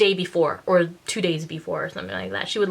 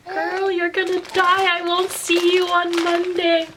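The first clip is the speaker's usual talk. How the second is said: high, slow mock doom; dramatic friend; 11 semitones higher than usual. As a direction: pronounce her doomed, hammed-up. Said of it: "my friend was like".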